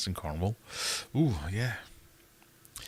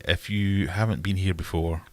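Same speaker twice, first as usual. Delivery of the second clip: usual speaking voice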